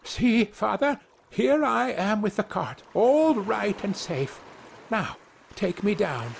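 put-on high proud little-boy voice; small boy calling to his father, pleased; Tom crying out. A narrator's reading. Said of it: "cried out".

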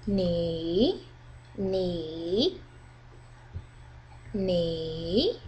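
"ni" drawn out, down then up; dipping tone. Shown as a demonstration. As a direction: dip "ni" low, then swing it up. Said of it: "it goes down".

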